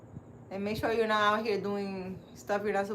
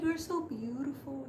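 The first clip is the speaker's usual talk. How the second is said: put-on softer, higher, dreamy admiring old woman; mesmerized old lady; 4 semitones higher, 6 dB quieter than usual. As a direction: breathe it like you're enchanted by her. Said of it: "She was like".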